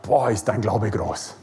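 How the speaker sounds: low, soft, slow amazement; God amazed at the kneeling woman's faith; awed God voice. Retold or imitated. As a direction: marvel quietly at her faith. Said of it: "Und Gott sagt".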